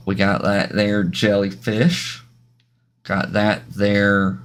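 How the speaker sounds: slow, stretched-out show-and-tell; drawn-out announcing. Pretend. Streamer presenting glyphs to viewers, in a playful slow drawl. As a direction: draw out each word as you present the item. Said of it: "I'm gonna do 'em all like this".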